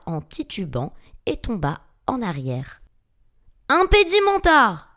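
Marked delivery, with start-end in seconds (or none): 3.7-4.9 high, loud incantation; full-voiced, high-pitched cry; desperate spell shout. A narrator's reading. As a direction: yell the spell as a last defence. Second desperate spell at the monster.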